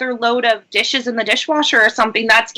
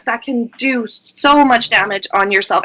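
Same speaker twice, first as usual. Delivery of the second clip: normal talking voice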